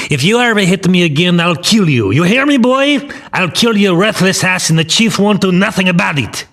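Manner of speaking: high, forceful gangster tirade; yelled threat. A narrator's reading. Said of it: "as Campanella yelled".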